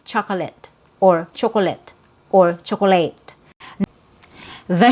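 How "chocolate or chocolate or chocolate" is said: "chocolate" as CHAH-kuh-lit, CHU-kuh-lit, CHU-kuh-layt; three-syllable chocolate. Shown as a demonstration. Teacher listing versions to avoid, three-syllable "chocolate". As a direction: sound the middle vowel in each "chocolate". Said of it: "So instead of".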